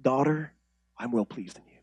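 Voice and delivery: soft, low, gentle reassurance; tender voice of God. Acted. Pastor voicing God's comfort to a broken believer, soft and low. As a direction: speak it softly, like a loving father. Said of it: "do you hear".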